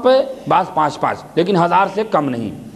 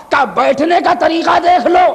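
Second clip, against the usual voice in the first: high call; 8 semitones higher, 3 dB louder than usual. Acted. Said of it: "ارے کیا کہنا".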